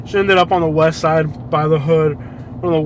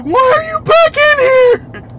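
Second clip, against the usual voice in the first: high, loud, drawn-out mock shriek; hammed-up hysterical outcry; imitating hysterical passenger; 7 dB louder, slower than usual. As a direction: wail it like a panicked passenger. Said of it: "she was hysterical".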